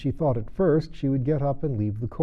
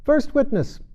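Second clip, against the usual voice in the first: much higher, louder herald's call; 6 dB louder than usual. A narrator's reading. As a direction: call the witness across the court. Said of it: "called out".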